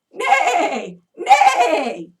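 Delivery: loud, high laughing whinny; horse neigh. Shown as a demonstration. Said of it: "I think a horse sounds like this".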